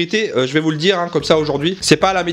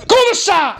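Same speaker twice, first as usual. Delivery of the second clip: high-pitched curse at the cat; hammed-up furious cry; angry yelling; 13 semitones higher, 3 dB louder than usual. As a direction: explode at the cat.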